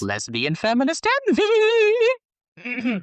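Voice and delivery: high, very slow theatrical delivery; dramatic mock voice. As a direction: announce it like a grand revelation. Mock dramatic note reading.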